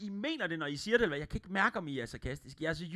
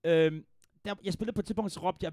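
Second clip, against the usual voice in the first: usual speaking voice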